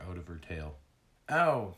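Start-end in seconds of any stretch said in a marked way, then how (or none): 1.3-1.7 sharp, higher, louder yelp of pain; narrated ouch, loud and high; sudden cry. A narrator's reading. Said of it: "Tag cried suddenly".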